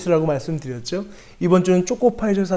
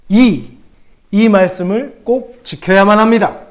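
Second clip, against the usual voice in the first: loud call; 4 semitones higher, 6 dB louder than usual. Acted. Pastor calls out acrostic.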